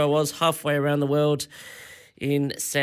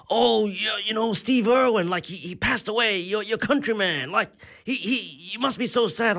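higher pitched, gushing sympathy; hammed-up American sympathy, pitched higher; mock earnest American; 7 semitones higher than usual. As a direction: overdo the stranger's heartfelt concern. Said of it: "this random American person".

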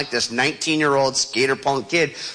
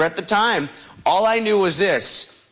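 normal talking voice

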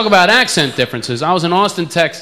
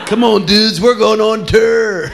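loud rock-star countdown, higher and higher; 7 semitones higher, 4 dB louder, much slower than usual. Retold or imitated.

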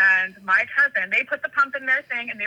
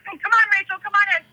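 high, louder mock coaxing; imitated eager guide; beckoning call; 10 semitones higher, 5 dB louder, faster than usual. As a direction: call someone in, hammed-up. Guiding accomplice, retold.

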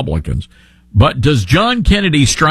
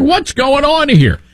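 baffled; 11 semitones higher than usual. Retold or imitated. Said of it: "You're like".

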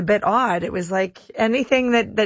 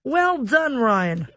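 higher-pitched, amateur Aussie impersonation; bad Australian accent; 5 semitones higher, slower than usual. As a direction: cheer on the renovation in a put-on Australian accent. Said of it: "craft my Australian accent".

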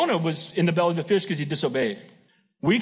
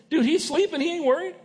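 light, higher, easygoing mock voice of a disciple; disciples' casual remark; 10 semitones higher than usual. Preacher voicing a disciple about sleeping Jesus, breezy and higher. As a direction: toss it off like a laid-back fisherman.

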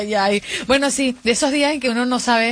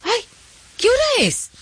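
much higher, startled cry; startled little girl; 13 semitones higher than usual. Retold or imitated. Little sister waking from a nap, startled high. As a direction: wake with a jolt and ask. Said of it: "ella se levanta y dice".

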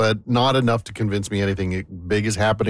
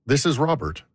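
stiff self-announcement, higher voice; formal phone answer; 5 semitones higher than usual. Shown as a demonstration. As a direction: announce yourself formally, as if to an unknown caller. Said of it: "refer to myself in this form".

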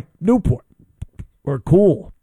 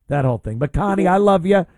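ordinary talk

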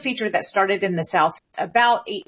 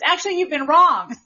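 higher-pitched mock announcement to parents; students correcting parents; 7 semitones higher, 3 dB louder than usual. Students telling their parents at dinner, imitated in a higher voice. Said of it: "they tell their parents".

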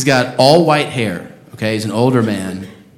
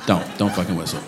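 softer, lower, menacing undertone; quiet warning; 7 semitones lower, 8 dB quieter than usual. Shown as a demonstration. Comic to the whistler again: quiet menacing warning. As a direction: drop it to a quiet threat. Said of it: "that was a little aggressive".

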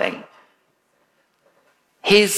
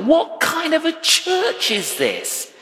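high, incredulous mock outrage; indignant inner thought; 13 semitones higher than usual. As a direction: squeak out your disbelief at the church. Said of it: "And I thought".

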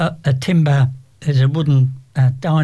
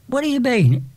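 put-on much higher, a baffled teen girl asking; 10 semitones higher than usual. Girl baffled by cockney slang, high puzzled question. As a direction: squeak out puzzled confusion.

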